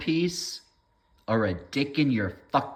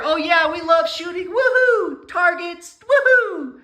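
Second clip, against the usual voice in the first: mocking shooting fan; 9 dB louder, slower than usual. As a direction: whoop like an overexcited target shooter. Mocking gun enthusiast cheering.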